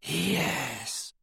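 soft, eerie snake whisper. A narrator's reading. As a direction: breathe it like a hissing serpent. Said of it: "whispered the voice".

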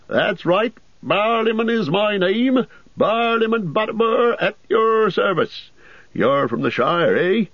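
high, bustling landlord voice; a cheery host bending down to greet; jolly innkeeper. A narrator's reading. Butterbur introduces himself.

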